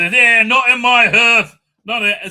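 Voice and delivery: hammed-up drunken lord roaring; booming refusal. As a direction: bellow it out like a lord laying down the law. Lord refuses, voiced in retelling.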